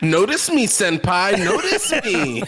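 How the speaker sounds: high, pleading anime fan voice; excited anime fan. Pretend. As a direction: beg senpai to notice you. Anime fan meme quote.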